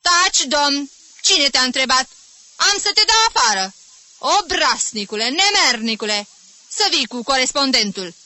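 loud, high barking rage; furious outburst. Acted. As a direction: snap like a chained dog at the pupil. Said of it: "sări ca un câine rău". (izbucnire furioasă, tare și ascuțită)

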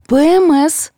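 high, abrupt blurt. A narrator's reading. Nina blurting an explanation for the outburst, tactlessly. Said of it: "брякнула Нина".

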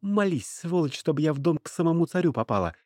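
high-pitched, relentless warning; threatening slave girl. A narrator's reading. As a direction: threaten him insistently, pitch held high. Slave girl threatens former master.

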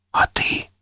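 soft whispered question; whispering. A narrator's reading. Никита asks his partner about his part, whispering. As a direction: whisper it to the one beside you. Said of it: "прошептал он".

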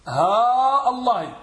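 ها drawn out long, then الله with its hamza; lengthening and glottal stop. Shown as a demonstration. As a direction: hold ها slowly, then cut into الله. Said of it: "بالمد".

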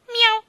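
high, loud, short mew; piercingly pitched imitation meow; cat meow. Cat asking the king for its share, loud meow. Cat meows between its words.

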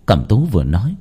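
plain reading voice

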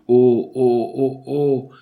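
loud throaty cries of "oh"; hoarse repeated "oh".

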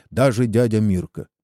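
plain reading voice

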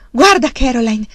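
loud, high, excited calling; trembling excitement. A narrator's reading. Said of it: "disse Charles tremando".

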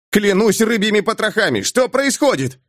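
high alarmed exclamation; crying out. Acted. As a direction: cry out in shock. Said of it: "вскричал молодой матрос".

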